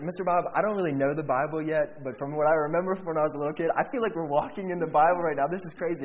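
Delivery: retold excited outburst; amazed. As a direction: gush it out in disbelief. Amazed, tells Bob it is crazy.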